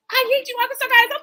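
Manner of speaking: high, loud, rushed mock outburst; mock angry commenter. Angry commenter to the tarot reader, mock yelling. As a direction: imitate a hater yelling, fast and high.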